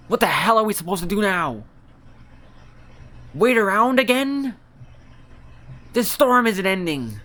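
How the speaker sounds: high, loud exasperated outburst; angry complaint. Acted. A stranded villager raging at the endless storm, high loud outburst. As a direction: vent your frustration, loud and high.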